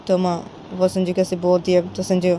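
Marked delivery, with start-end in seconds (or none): none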